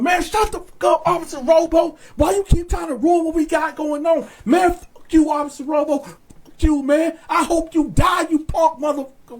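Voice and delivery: high-pitched, heated mock yelling; retold yelling. Inmates yelling back at Officer Robo, imitated angry yell. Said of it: "So we got the yelling on him".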